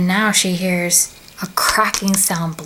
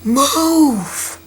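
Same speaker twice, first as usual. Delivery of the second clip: higher-pitched, drawn-out yell; 5 semitones higher, 4 dB louder than usual. A narrator's reading. Runner behind Clover orders her aside, yelling. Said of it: "he yells out".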